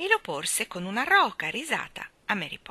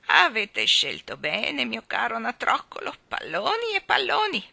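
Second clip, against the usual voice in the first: voce alta e forte da vecchietta; 5 semitones higher, 6 dB louder than usual. A narrator's reading. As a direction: cantilena da vecchia venditrice. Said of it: "disse la vecchietta".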